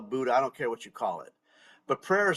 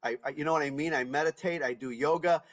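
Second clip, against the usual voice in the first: usual speaking voice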